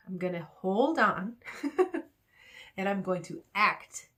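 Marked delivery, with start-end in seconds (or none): 1.4-2.0 short high chuckle; brief burst of laughter. Real, not acted. Amused at own analogy.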